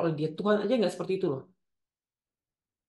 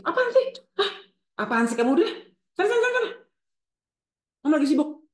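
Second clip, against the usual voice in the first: high, loud, irritated brush-off; dismissive mother; 10 semitones higher, 6 dB louder, faster than usual. Acted. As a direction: act out a busy mother shooing her child away. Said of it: "Mengabaikan perasaan dan kebutuhan Anda". (ibu ketus mengusir anaknya)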